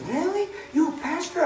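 high-pitched, soft retold surprise; surprised question. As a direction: imitate a seatmate's astonished question, high and light.

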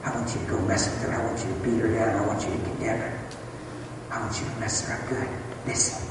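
low, hushed conspiratorial devil; quiet, quick menacing instructions to a henchman; scheming devil. Acted. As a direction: give sinister orders under your breath. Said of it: "So the devil says".

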